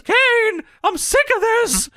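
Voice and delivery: very high, slow mock plea; hammed-up wail of a fed-up captive; Paul Bearer. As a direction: drag out the pleading, high and whiny. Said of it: "and Paul Bearer says".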